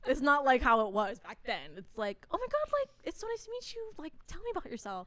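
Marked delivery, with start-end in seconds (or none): 2.3-5.1 much higher, gushing fake-friendly voice; sugary scammer. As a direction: sweeten it up like a smooth-talking fake director. Imitating the sweet scammer director.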